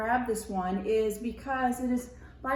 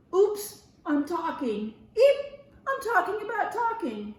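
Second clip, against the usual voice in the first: high startled squeak with a nervous laugh; flustered oops; 8 semitones higher, 6 dB louder than usual. Acted. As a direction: catch yourself in dismay. Friend catches himself talking.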